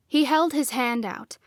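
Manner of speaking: plain reading voice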